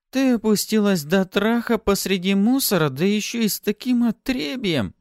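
high, loud, scornful woman's voice; lip-curled disdain. A narrator's reading. Newcomer berating Pafi for her company, disgusted. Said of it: "Она скривила губы".

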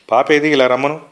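plain reading voice

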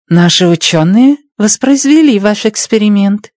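put-on Chinese accent. A narrator's reading. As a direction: speak Russian with a Chinese accent. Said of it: "произнесла с китайским акцентом".

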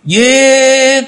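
hét lớn, cao vút; gào một tiếng giết; hét vang. A narrator's reading. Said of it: "bỗng lại cùng hét vang". (tiếng hét vang rền)

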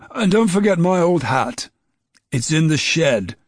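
called out after someone leaving; calling out. A narrator's reading. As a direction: raise the voice to reach a boy going out the door. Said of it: "Dad called out after him".

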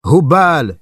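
high, loud cry of the idol's name. A narrator's reading. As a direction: cry out Hubal in triumph. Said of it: "Puis il cria". (cri de triomphe, haut et fort)

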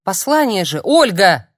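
loud high shriek. Acted. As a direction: scream it at her. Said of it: "взвизгнула Ольга".

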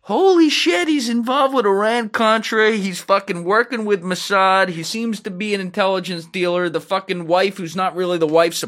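an agent losing it over the discovery, hammed-up panic; panicked outburst. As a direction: blurt it out in alarm, then calm toward the end. Analyst panicking, acted out.

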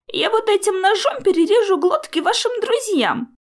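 high-pitched, hoarse villain's threat. A narrator's reading. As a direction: threaten them, straining high. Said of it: "хрипло объявил он". (хриплая угроза на высоких нотах)

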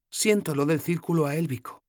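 voiced young heroine, gently teasing; Sefawin's voice. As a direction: play the tired, teasing young woman.

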